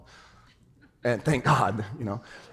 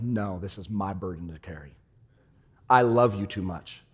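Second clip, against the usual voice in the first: quiet tender Jesus; 10 semitones lower, 9 dB quieter than usual. Retold or imitated. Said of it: "He's like".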